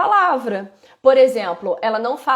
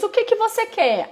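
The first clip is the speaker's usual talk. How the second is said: much higher, sing-song question; parent asking child; 10 semitones higher than usual. Shown as a demonstration. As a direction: lift it up for a little one.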